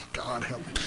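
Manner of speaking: quiet aside to himself; muttered aside. Real, not acted. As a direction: drop it under your breath. Muttered aside mid-sermon.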